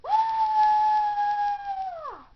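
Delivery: high piercing shriek out of nowhere; a shrill burst of fright; sudden scream. Acted. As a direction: let out a startled, very loud scream. Sudden scream in dark school.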